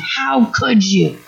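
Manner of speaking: drawn-out, hurt accusation; slow mock personal attack. Parent attacking a child with a slow, hurt accusation. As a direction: lean on it like a wounded parent. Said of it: "rather than make a personal attack".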